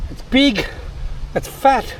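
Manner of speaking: high, delighted about the catch; excited. Real, not acted. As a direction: gush over the fish. Showing off caught carp.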